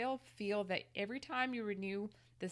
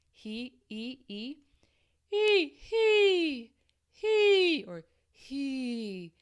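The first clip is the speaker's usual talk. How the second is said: airy e vowel, some far higher; breathy he; 7 semitones higher, 12 dB louder, much slower than usual. Shown as a demonstration. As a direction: let air leak through the e. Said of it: "with that breathiness".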